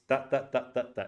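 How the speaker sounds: "da, da, da" as equal, separate beats; even syllable beats. Shown as a demonstration. Teacher showing syllable rhythm of Italian and Spanish, even beats on each "da". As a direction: tap out each "da" evenly. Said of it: "It's about the syllables".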